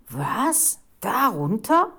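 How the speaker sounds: appalled. A narrator's reading. Reporter facing the dark cellar stairs, appalled. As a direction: question it in mild horror. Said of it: "Die Reporterin war entsetzt".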